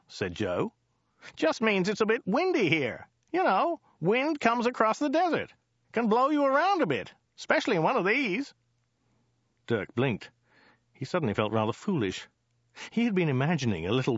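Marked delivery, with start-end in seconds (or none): 1.4-8.5 high-pitched chatty driver; high, matter-of-fact driver; Joe the driver, explaining. Acted. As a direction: explain it simply in a high character voice.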